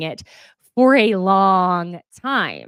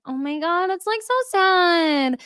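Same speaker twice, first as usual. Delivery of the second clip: mocking the girls; 8 semitones higher than usual. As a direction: overdo the hollow concern. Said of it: "the girls are just like".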